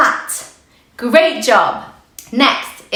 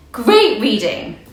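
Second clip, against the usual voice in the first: ordinary talk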